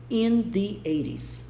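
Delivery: slow, careful reading of in the eighties; slow highlighted phrase. Shown as a demonstration. Teacher picking out the phrase for students, slowly. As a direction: set the phrase apart slowly. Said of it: "que eu quero que você veja primeiro".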